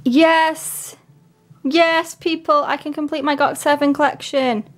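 high-pitched, delighted cheer; triumphant excitement. Real, not acted. Fan telling viewers the collection is complete, triumphant.

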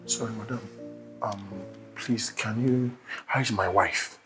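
very soft, low, hesitant; hushed timid plea. Acted. Husband asking nurse about wife in surgery, hushed timid plea. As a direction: ask meekly, almost under your breath.

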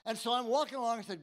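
ordinary talk

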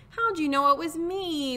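high, plaintive turkey wail. Acted. Disguised Turkey found out by Sheep, plaintive wail. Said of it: "wailed Turkey".

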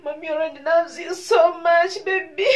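real crying mother; tearful love. Real, not acted. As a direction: sob it out tenderly. Crying, talking to her baby.

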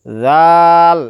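জাল as a soft dh, long and loud; soft articulation. Shown as a demonstration. Teacher models the letter, long জাল with a soft consonant. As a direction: stretch জাল gently off the tongue tip. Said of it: "নরমভাবে আদায়".

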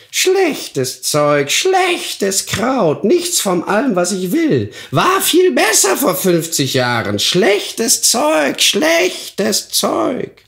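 high, scornful witch grumble; old witch muttering over the basket; muttering hag. Acted. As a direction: sneer at the herbs like a disgusted crone. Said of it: "murmelte sie".

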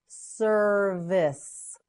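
"Service" slowly as ser, vice (vis); syllable by syllable. Shown as a demonstration. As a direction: stretch both syllables of "Service". Teacher models word by syllables.

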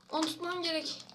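ordinary delivery

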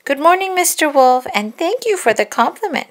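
put-on little girl cheerfully greeting the wolf. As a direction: chirp it like a happy little girl. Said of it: "she replied cheerfully".

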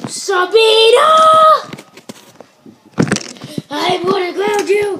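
high, loud call-out; mock scolding shout. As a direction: yell it like a pretend telling-off.

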